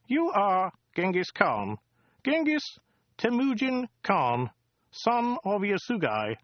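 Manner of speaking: high, precise, weaselly alien; a fussy, superior bureaucrat; prim alien voice. Acted. Wowbagger names Khan.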